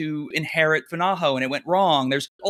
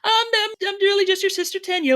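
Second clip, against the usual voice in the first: put-on much higher, giggling girl's voice; giggly reveal. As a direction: giggle it out, sweet and coy. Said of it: "she turns around and giggles".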